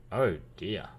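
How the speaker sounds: ordinary delivery, nothing marked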